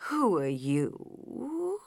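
very slow, soft and drowsy; slow sleepy voice. A narrator's reading. Caterpillar to Alice, slow sleepy question. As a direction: drag the words out half asleep. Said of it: "in a slow, sleepy voice".

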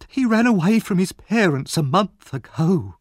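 high, loud tearful complaint. A narrator's reading. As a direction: wail the accusation to onlookers. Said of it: "She burst into tears".

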